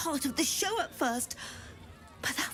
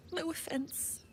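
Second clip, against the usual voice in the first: whispered aside; 7 dB quieter than usual. Acted. Aside after insult.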